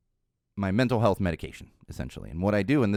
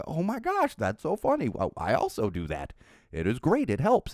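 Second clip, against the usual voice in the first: delighted; 6 semitones higher than usual. Retold or imitated. Group laughing, sharing the same meds.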